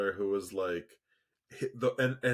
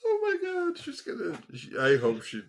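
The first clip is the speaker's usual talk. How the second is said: laughing; 5 dB louder than usual. Real, not acted. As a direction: still laughing, high and breathless. Reacting through laughter.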